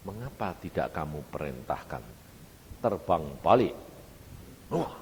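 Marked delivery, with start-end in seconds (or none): 0.1-3.8 low, measured inner prompting; lightly voiced Spirit, low and restrained. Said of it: "Roh Kudus ngomong dalam hati saya". (suara batin Roh Kudus, rendah)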